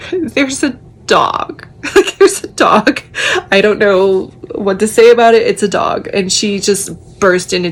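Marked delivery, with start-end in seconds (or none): 0.1-1.7 slow, higher, dumbfounded "There's a dog"; baffled deadpan announcement; stunned. Retold or imitated. 1.7-5.5 high, louder, giggling through the words; real laughter bubbling over; laughing. Real, not acted. Laughing at dog's arrival.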